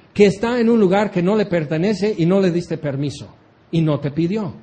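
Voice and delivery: ordinary delivery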